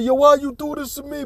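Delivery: high, hammed-up whimpering plea; mock crying plea. Husband begging his cheating wife, mock-crying high. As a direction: beg like a heartbroken husband, half-joking. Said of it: "He over there crying and begging".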